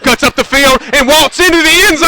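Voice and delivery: building from lively to a high, loud shout; touchdown call. Real, not acted. Announcer calling a touchdown run for home listeners, ecstatic. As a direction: roar it as he breaks into the end zone.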